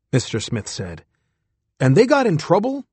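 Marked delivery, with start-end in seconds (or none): 1.8-2.9 surprised question. Acted. Old astronaut worried question.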